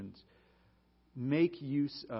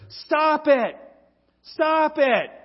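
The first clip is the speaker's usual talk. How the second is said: sharp, loud, higher bark of a command; Bob Newhart's blunt shout; 10 semitones higher, 11 dB louder, slower than usual. Retold or imitated. Counselor cutting off his rambling patient, blunt loud shout. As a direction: snap it like a fed-up counselor cutting her off. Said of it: "he just keeps saying to her".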